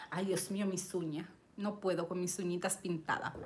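quiet aside. Real, not acted. Mumbles about her nails aside.

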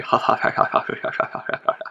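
long, breathy run of ha-ha-ha; breathy laugh. Acted. Player spots Mount Sinai and lets out a long breathy laugh. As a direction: let a voiceless laugh trail on and on.

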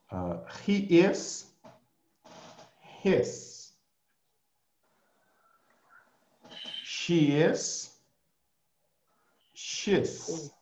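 teacher modelling words slowly for repetition; slow, clear drill for students to echo; slow drill model. Shown as a demonstration. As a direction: say each form clearly, pausing for the class.

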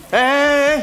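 high-pitched warning yell; la lên. Acted. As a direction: cry out sharply to stop someone. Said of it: "anh đừng la lớn anh ơi". (la lên giọng cao để cản lại)

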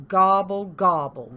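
loud grumbled gobble; grumbling turkey. A narrator's reading. Turkey rebuffed by cow, grumbling gobble. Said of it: "grumbled Turkey".

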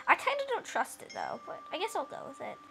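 ordinary delivery

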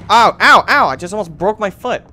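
loud, high cries of pain; pained yelling. Real, not acted. Player nearly breaking his foot in game, pained. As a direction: yelp repeatedly, then complain loudly.

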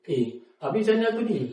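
lightly hammed-up confusion; mock bewildered. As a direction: retell his panicked reaction. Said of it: "quoting himself, puzzled".

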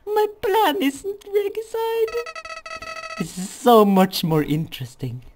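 high, loud menacing flower; Flowey voice. Acted. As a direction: scheme aloud, high and loud. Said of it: "my flowery voice".

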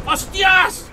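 loud, high startled swearing; sudden yell of shock; shocked shout. Real, not acted. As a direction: burst out in alarm. Sudden danger in game.

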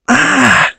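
sudden high, loud shriek; a loud imitation of the baby's yelp; imitating baby scream. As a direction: mimic the baby's outburst. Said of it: "she goes".

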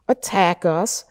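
ordinary talk

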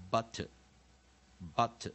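"butter" said "BOT-uh", second vowel reduced; spaced teaching repeats of "butter", weak ending; weak-vowel drill. Shown as a demonstration. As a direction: drill "butter" slowly as "BAH-tuh". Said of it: "第三音节落母音".

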